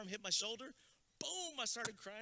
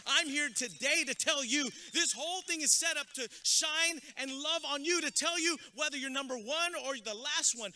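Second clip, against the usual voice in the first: loud, high preacher's build to the graduates; rally the crowd at full force; preaching; 6 semitones higher, 10 dB louder than usual. Acted. Speaker firing up graduates at commencement, loud preaching.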